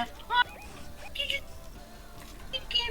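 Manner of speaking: high squeaky mock neighbour girl; mocking the neighbour girl's clueless reply. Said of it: "la morrilla estaba".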